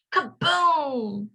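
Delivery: kaboom. A narrator's reading. Explosion sound word.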